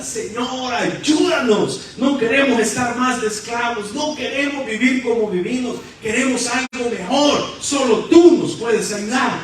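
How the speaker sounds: high, impassioned plea building to a shout; crying out for help. Acted. Enslaved people begging God for rescue, acted desperate cry. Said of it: "gritaba pidiendo ayuda".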